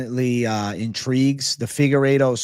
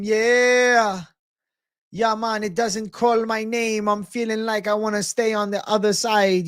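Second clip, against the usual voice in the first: high, slow, drawn-out Jamaican accent; 8 semitones higher than usual. Shown as a demonstration. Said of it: "Gotta do the Jamaican accent".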